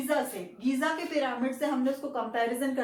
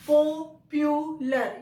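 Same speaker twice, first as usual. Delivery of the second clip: "Popular" very slowly in three separate parts, each punched louder; split into syllables; 5 dB louder, much slower than usual. Shown as a demonstration. Teacher counting syllables for students, "popular" split in three. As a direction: break "popular" into three loud, drawn-out syllables. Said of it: "तीन parts करके उच्चारण कर".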